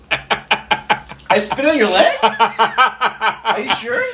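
high-pitched question through real laughter; laughing disbelief. Real, not acted. Spitter asks co-host about the leg, laughing. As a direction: ask it incredulously while cracking up. Incredulous, cracking up over the spit.

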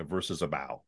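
"bow" said to rhyme with cow; soft "bow" with an open ow sound; ow vowel in bow. Shown as a demonstration. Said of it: "differences are subtle in terms of ow".

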